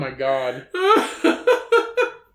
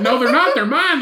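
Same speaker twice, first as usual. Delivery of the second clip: loud mock denial; retold bickering; 5 semitones higher, 5 dB louder than usual. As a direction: imitate a stubborn squabbler, on the edge of laughter. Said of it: "about these stupid fucking".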